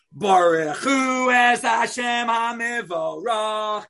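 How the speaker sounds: high, loud, slow shouted prayer; fervent shout. Acted. Man praying aloud in first class, a fervent high shout. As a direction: shout the prayer with wild fervor. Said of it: "shake and shout with fervor".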